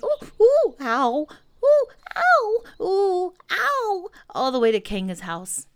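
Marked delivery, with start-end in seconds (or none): 0.0-4.1 string of high, loud yelps; bouncing oohs and ows. A narrator's reading. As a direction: yelp at every bump of the ride. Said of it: "as he was saying".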